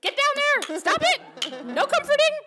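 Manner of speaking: high, loud mock scolding. Sarah told to stop comforting fans, mock scolding loudly. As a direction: bark it like a bossy friend, hammed up. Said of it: "And she was like".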